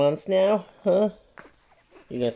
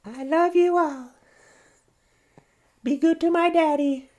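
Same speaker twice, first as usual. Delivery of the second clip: put-on baby's voice; 14 semitones higher, 3 dB louder than usual. Dad voicing his baby, asking viewers to subscribe in a high voice. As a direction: speak as the tiny baby.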